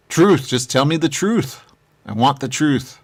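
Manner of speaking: demanding truth. Acted.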